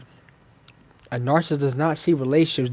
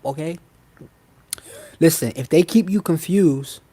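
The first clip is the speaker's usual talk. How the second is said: ordinary talk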